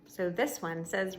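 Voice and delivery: plain reading voice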